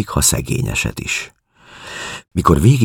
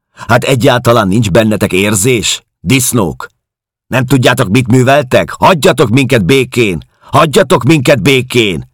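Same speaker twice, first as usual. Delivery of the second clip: raised, forceful cursing that builds to high pleas; loud and hard; 7 semitones higher, 6 dB louder than usual. A narrator's reading. Woman cursing the men who pester the prisoners, loud and hard. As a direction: hurl curses at him, hard and loud. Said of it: "mondta hangosan és keményen".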